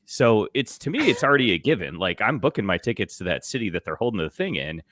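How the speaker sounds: ordinary delivery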